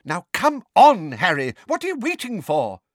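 high, exasperated order; furious. A narrator's reading. Neighbour hurrying Harry along, furious. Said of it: "said Missus Figg furiously".

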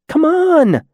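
very high-pitched urgent call. A narrator's reading. Green-masked demon hurrying her friend along, urgent. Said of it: "the other demon said urgently".

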